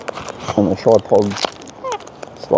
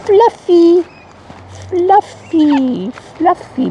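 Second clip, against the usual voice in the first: high pet talk; much slower than usual. Real, not acted. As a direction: coo the name up high like calling a pet. Cooing at her fluffy bird.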